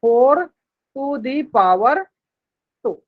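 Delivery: four to the power two, stretched very slowly with pauses; teacher models the first reading very slowly; slow demo reading. Shown as a demonstration. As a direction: spell it out slowly, word by word. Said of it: "ये था पहला पढ़ने का तरीका".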